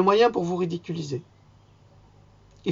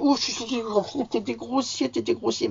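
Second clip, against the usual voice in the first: higher, put-on scolding voice tutting at himself; mock self-scolding; 6 semitones higher than usual. A prim voice scolding the vlogger for his crude language. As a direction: play a prim voice telling him off. Je, je suis grossier aujourd'hui.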